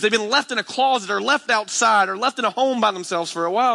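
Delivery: high-pitched, urgent preacher plea; impassioned preaching. Real, not acted. Preacher urging church to take in children, impassioned.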